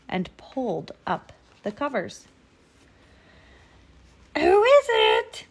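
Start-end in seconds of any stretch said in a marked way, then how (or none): 4.4-5.5 very high, loud, slow call; wolf posing as a sweet granny; sweetest Granny voice. A narrator's reading. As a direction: call out in an exaggerated old-lady falsetto. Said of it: "in his sweetest Granny voice".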